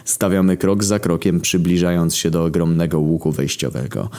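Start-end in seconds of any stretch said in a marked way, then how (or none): none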